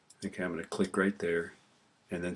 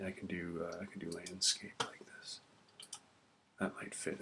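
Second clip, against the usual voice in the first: soft under-the-breath whisper to herself; hushed thinking-aloud whisper; whispered aside; 12 dB quieter than usual. Real, not acted. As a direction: mutter it quietly while working the mouse. Teacher mutters to self at computer.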